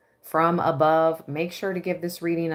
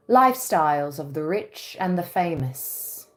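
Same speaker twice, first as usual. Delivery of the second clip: a faint British accent, laughing into it; light mock British announcer voice; little British accent. Shown as a demonstration. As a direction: try a British TV host accent, half-hearted. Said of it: "not really British. A little, uh, British accent".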